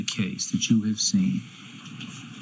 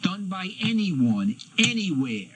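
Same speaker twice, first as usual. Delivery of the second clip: shouting; 7 semitones higher than usual. Real, not acted. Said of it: "shouting at my witness".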